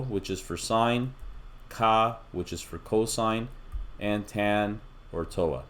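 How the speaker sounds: So, ka and toa said slowly, one part at a time; breaking down the acronym. Shown as a demonstration. Teacher slowly splitting sokatoa into its parts for students. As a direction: lay out each syllable slowly. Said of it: "just remember there's three parts to it".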